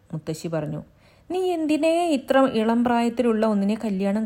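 lightly voiced stern grandmother; scolding grandmother. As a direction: reprove him like an elder.

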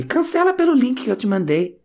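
high mock scammer voice; imitating the scammer. Mimicking the scammer telling him to cancel by link. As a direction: voice the seller pushing his link.

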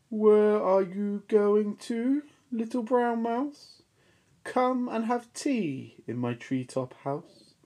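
high, slow, smooth owl voice; sly owl. Acted. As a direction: coax the mouse in slowly. Owl invites mouse.

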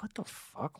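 puzzled, barely voiced whisper; hushed disbelief. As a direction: whisper it to yourself, baffled. Puzzled private reaction to unknown caller.

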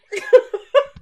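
high burst of laughter; laughing. Real, not acted. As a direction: break up laughing. Laughing at the count.

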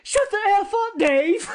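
high, loud mock outburst of a scared kid; imitating a panicked child snapping back; freaked-out yell. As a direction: yell it at him, hammed up for laughs. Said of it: "freaking out. He's, like, scared".

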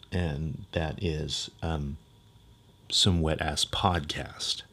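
low, slow, quiet drawl. Acted. Slurping joke, podcast intro.

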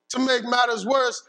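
usual speaking voice

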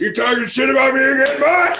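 scolding, deepened tough-guy voice; deep voice. Acted. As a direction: bark it like a menacing dad. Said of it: "the voice just deepens".

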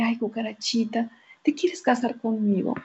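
shy whispered proposal. Acted. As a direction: whisper it as the timid mouse. Mouse proposes to cucarachita.

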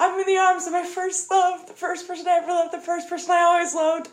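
imitating Allison's dying speech. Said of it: "She's like".

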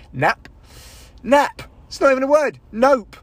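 high, loud flustered inner voice; panicked brain voice. Retold or imitated. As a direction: squeak it out like a brain short-circuiting. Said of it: "how your brain just goes".

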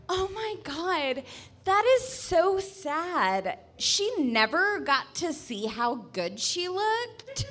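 high, drawn-out mock sympathy; Samantha. Friend hearing of blind anorexic woman, gushing mock pity.

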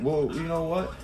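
ordinary talk, nothing marked

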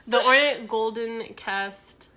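laughing. Real, not acted. Reading while still laughing.

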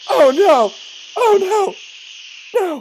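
high, loud wail of dismay; hammed-up horrified shriek; horrified cry. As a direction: cry out as the painting is ruined. Art being sprayed with juice.

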